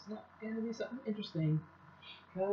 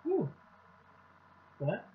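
talking between bites; chewing; 2 semitones higher, 3 dB louder than usual. Real, not acted. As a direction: mouth partly full. Seen 2: Lips closed with jaw working between words, bowl of food in front.

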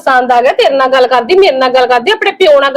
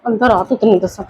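hushed scolding; 9 semitones lower, 6 dB quieter than usual. Acted. Mother hushes daughter so nobody hears, low and soft. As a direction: lower and softer, keeping it from the house. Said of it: "ਹੁਣ ਮੈਂ ਉੱਚੀ ਬੋਲ ਕੇ ਤੈਨੂੰ ਕੁਛ ਕਹਿ ਵੀ ਨਹੀਂ ਸਕਦੀ".

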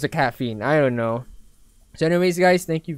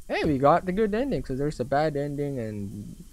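normal talking voice

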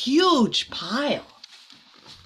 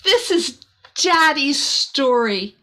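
slow, loud, high joyful cry; the princess thrilled to find her father's book; delighted cry; 6 semitones higher, 4 dB louder, slower than usual. Acted. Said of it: "cried in delight".

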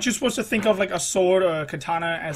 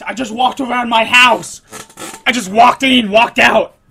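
loud, raised-voice complaint; exasperated outburst; 2 semitones higher, 8 dB louder than usual. Real, not acted. Player griping at the game, exasperated and loud. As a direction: protest loudly in disbelief.

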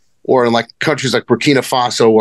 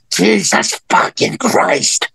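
slow, drawn-out disbelief, pitched higher; a friend groaning in slow disbelief; imitating Kevin; 6 semitones higher, much slower than usual. Kevin reacting to the gun.

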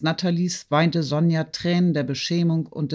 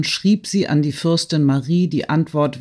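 ordinary delivery

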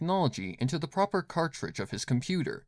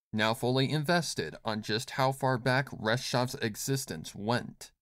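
ordinary delivery

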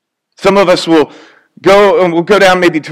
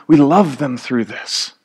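tender; 3 semitones lower, 6 dB quieter, slower than usual. Real, not acted. Tender point about loving the sick.

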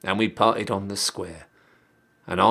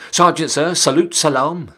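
loud, higher sentry call; called-out salute; 6 semitones higher, 6 dB louder than usual. Acted. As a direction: bark it out like a sentry greeting an officer.

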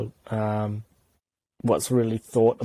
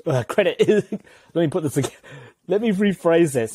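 flustered, higher-pitched restart; rattled; 6 semitones higher, 3 dB louder than usual. Real, not acted. Tongue-tied guest restarting his point on air, rattled. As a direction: lose your thread and scramble to restart, pitch up. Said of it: "Got 'em rattled, boys".

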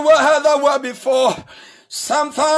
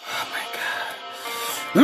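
slow breathless whisper of dismay; hushed whisper; 10 dB quieter, much slower than usual. Real, not acted. Pastor overwhelmed by the people's abuse, whispering slowly. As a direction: drop to a whispered groan. Hushed aside amid shouting.

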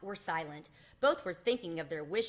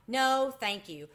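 firm refusal; 6 semitones higher, 6 dB louder, slower than usual. A narrator's reading. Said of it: "Ralph was horrified".

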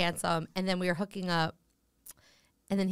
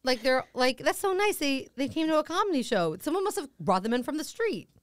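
naive delight; 7 semitones higher than usual. Retold or imitated. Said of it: "I'm like".

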